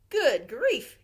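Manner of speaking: loud startled exclamation; startled gasp. A narrator's reading. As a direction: blurt it out in shock. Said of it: "gasped Grandpa Dan".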